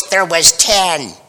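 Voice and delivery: slow, flat-out spouse correcting "There was ten". Retold or imitated. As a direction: cut in dryly, like a spouse setting the record straight on "There was ten". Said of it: "suddenly you hear this voice".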